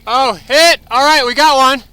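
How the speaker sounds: loud call to teammates. Real, not acted. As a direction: call it out across the field. Calling out to teammates mid-game.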